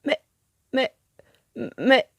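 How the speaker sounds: stuttering, confused rising plea; bewildered stammer. A narrator's reading. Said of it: "bredouille Juanito".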